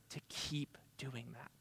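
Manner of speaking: hushed whisper of commitment. Real, not acted. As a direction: lean in and whisper the promise. Intimate appeal to the congregation.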